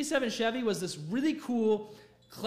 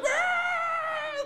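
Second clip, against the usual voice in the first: very high, drawn-out fake sob; hammed-up squealing cry; mock crying wail; 4 dB louder than usual. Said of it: "Like, he's crying so much".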